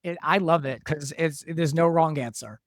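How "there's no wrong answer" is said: ordinary talk, nothing marked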